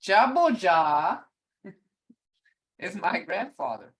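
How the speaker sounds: ordinary delivery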